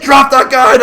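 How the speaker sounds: hammed-up police command; cop yell. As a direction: yell the order at gunpoint. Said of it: "cop repeating order".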